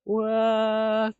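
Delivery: a yelp of real disbelief; shocked what. Real, not acted. Streamer caught off guard by his game, loud high shocked cry.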